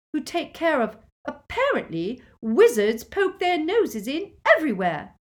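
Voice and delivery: high, loud indignation; scornful. A narrator's reading. Defensive quarrel, Petunia interrupts scornfully.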